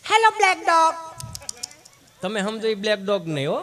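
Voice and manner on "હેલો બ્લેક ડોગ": prissy English dog-calling; mock call to dog. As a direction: coo at the dog like a fancy visitor.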